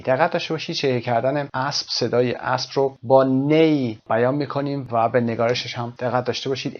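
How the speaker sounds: ordinary talk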